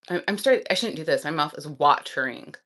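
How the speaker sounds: normal talking voice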